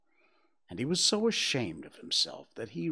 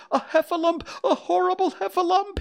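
high, loud panicked squeal; Piglet crying out; 8 dB louder, faster than usual. A narrator's reading. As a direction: raise the alarm in terror. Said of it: "cried Piglet".

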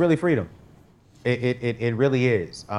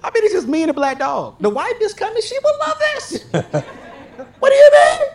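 very high squeaky begging, rising to a shout; squeaky mock plea; 5 dB louder than usual. Begging God for the house, very high and squeaky. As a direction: whine like a kid talking God into it.

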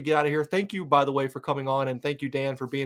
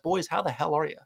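ordinary delivery

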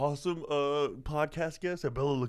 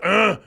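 caveman grunts; 5 semitones higher, 12 dB louder than usual. Pretend.

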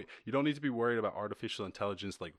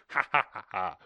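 loud laugh; 8 dB louder than usual. Real, not acted. Laughing at the hack.

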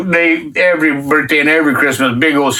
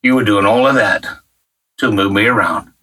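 awed prayer; 7 semitones lower than usual. Retold or imitated. Realizing why God moved him there, hushed and low.

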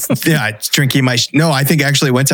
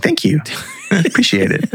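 laughing thanks, pitched up; grateful with a laugh; 5 semitones higher than usual. Retold or imitated. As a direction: thank them while chuckling. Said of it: "I'd be like".

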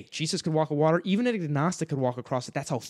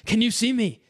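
demanding; 8 semitones higher, 5 dB louder than usual. A narrator's reading. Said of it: "he demanded".

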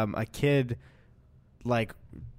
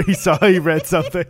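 normal talking voice

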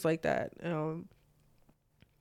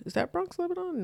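pitched up high, "Bronx" rising then "Lebanon" falling; a high, pressing repeat of the question; higher questioning; 11 semitones higher, 4 dB quieter than usual. Real, not acted. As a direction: ask it again much higher, checking with the listener.

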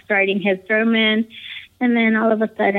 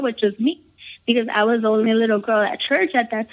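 ordinary delivery

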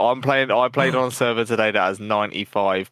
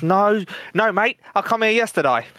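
higher Aussie punchline voice; mock Australian accent; 9 semitones higher than usual. Australian soldier answering the priest, higher mock Aussie accent. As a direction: deliver it as a cheeky Australian soldier. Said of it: "Hello, Australian".

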